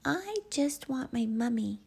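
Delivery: put-on drowsy child wanting comfort; sleepy child. A narrator's reading. Albie missing Mummy, high sleepy voice. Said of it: "Albie said sleepily".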